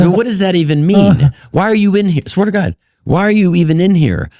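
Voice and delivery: high, hammed-up demand of why he is there; mock tough-guy grilling. Star grilling the comic about why he is there, mock indignant. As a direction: play a baffled star grilling an intruder, hammed up. De Niro grilling him in anecdote.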